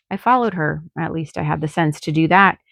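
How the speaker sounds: usual speaking voice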